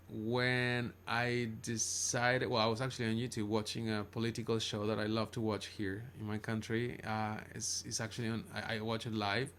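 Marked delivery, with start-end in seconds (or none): none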